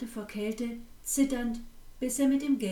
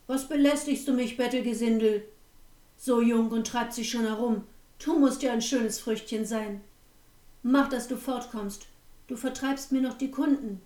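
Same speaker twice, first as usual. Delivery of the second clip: louder, harsh innkeeper chasing off a beggar; snapping at her; 4 dB louder than usual. Acted. Innkeeper shouting the freezing girl off his doorstep, louder and harsh. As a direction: bark her away from the door, louder. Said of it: "fuhr der Wirt sie an".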